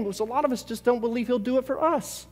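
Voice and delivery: usual speaking voice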